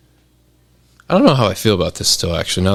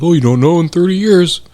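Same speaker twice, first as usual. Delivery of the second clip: mocking quote; 7 semitones higher, 5 dB louder than usual. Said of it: "the argument is always like".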